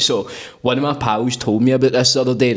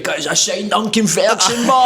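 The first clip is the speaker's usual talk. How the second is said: mock shout; 8 semitones higher than usual. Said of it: "You're shouting".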